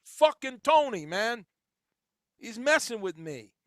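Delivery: loud, slow Jersey tough-guy voice; Jersey accent. Shown as a demonstration. Host showing how Jersey talk rubbed off on him. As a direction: lean into a New Jersey wiseguy. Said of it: "many years in Jersey".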